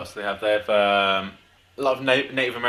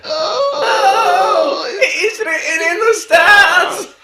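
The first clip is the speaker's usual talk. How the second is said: ecstatic commentator shout, much higher, louder and slow; Gary Neville shout; 8 dB louder, much slower than usual. Retold or imitated. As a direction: scream it like a goal call in a semi-final. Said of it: "Yeah, like that".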